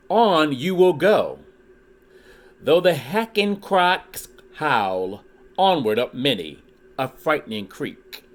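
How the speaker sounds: lively read-aloud with rhyming bounce; storybook reading. Acted. Reading a Dr. Seuss book to his YouTube viewers, lively storytelling. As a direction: read it like a children's picture book.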